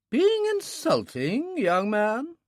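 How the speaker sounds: slow, high teasing question; mock offence, playful; querying old lady. A narrator's reading. Old lady queries the doctor's jibe, playful mock offence. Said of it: "she queried".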